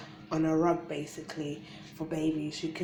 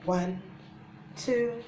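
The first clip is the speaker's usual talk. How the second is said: put-on teaching a child to count; 6 semitones higher, much slower than usual. Shown as a demonstration. Counting for babies.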